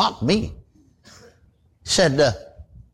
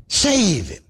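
urgent plea, a bit higher, set apart; pleading prayer; 4 semitones higher than usual. Retold or imitated. Preacher praying aloud for his lost uncle, pleading. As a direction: pray it out loud for him. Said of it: "'cause I prayed it out loud".

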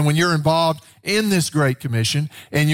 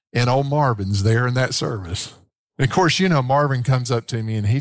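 normal talking voice

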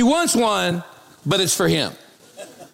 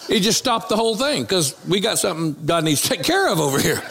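normal talking voice

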